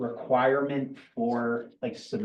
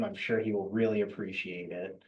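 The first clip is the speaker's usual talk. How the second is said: dry sarcasm, lower and softer; sarcastic; 4 semitones lower, 6 dB quieter than usual. Real, not acted. Official joking about the inspector's new title, sarcastic. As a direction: feign sincerity, letting the voice drop. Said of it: "I'm being sarcastic".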